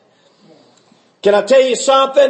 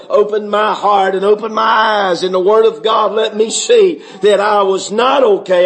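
normal talking voice

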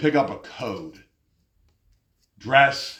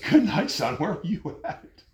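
laughing; 4 semitones higher, 5 dB quieter than usual. Real, not acted. Amused reply to the complainer.

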